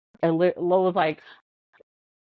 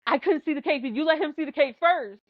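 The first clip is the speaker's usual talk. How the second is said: higher, offended demand for fairness; indignant accusation; 8 semitones higher than usual. Retold or imitated. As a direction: accuse her like a wronged kid. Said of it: "he walks up and he's like".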